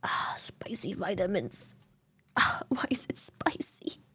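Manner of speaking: soft, voiceless gasp of words; strained whisper. Real, not acted. Streamer reacting to a spicy ginger shot, strained whisper. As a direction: whisper through the spicy burn.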